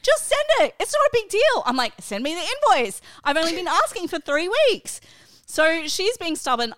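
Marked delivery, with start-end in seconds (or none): 0.0-1.6 much higher and louder, mock whiny daughter. Mum mimicking her daughter's dismissive whine. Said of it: "She's like". 2.0-5.0 higher, louder, fast exasperated demand; retold angry demand. Mum replaying her angry demand to her daughter. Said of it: "I'm angry".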